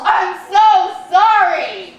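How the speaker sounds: high, drawn-out mock wail; mock anguished yell. Boyfriend covers his face and yells, mock anguish. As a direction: imitate a guilt-stricken yell, stretching each word. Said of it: "covers his face and yells".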